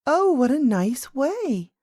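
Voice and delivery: put-on high, drawn-out girlish delight; slow, lifted exclamation of admiration; delighted cry. A narrator's reading. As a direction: cry out like a pleased little princess. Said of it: "cried the princess".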